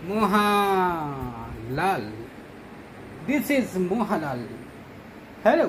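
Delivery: very slow, drawn-out English addressed to a cat; mock teacher English. Owner lecturing his cat Mohanlal in slow mock English. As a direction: scold and quiz the cat like a stern tutor.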